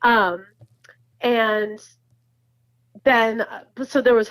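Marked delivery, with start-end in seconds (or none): none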